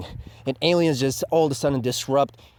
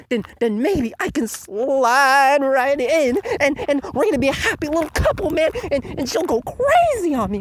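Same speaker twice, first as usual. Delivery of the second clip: much higher, giddy lovesick voice; mock lovestruck nerd; 10 semitones higher than usual. Mocking Shinjiro gushing over the idol, high giddy voice. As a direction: swoon like a dorky teen over a girl. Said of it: "And he's like".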